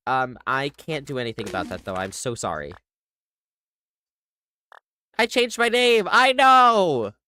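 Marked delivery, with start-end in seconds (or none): 5.2-7.1 loud, high exasperated yell; mock outburst. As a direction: shout it in exaggerated frustration. Mock outburst repeating the excuse.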